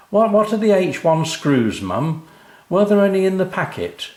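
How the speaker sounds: high, loud, hurried question; scrambling for the missing screws; flustered dad. A narrator's reading. Dad asking Mum about the screws, high and flustered.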